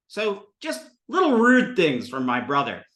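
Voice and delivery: ordinary delivery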